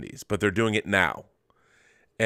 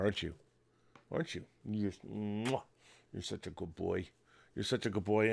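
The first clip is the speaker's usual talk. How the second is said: much softer, cooing praise; doting dog talk; 12 dB quieter than usual. Real, not acted. Praising his dog on camera, softly cooing.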